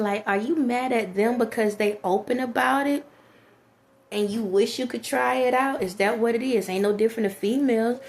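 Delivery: ordinary talk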